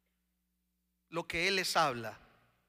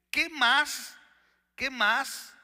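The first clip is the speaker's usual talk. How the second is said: high, loud rhetorical appeal; insistent question; 7 semitones higher, 4 dB louder, much slower than usual. Acted. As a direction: press the repeated question, pitched high. Preacher acts out the author's insistent question. (pregunta insistente, aguda y fuerte)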